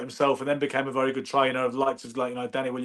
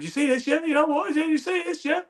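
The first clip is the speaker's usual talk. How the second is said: much higher-pitched impression of a cockney boxing trainer; a pitched-up mimic of the trainer flashing the cash; Jim McDonnell impression; 12 semitones higher than usual. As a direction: put on Jim's voice, pitched way up, showing off. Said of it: "Jim talks a bit like that".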